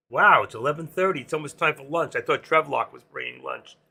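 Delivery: ordinary talk, nothing marked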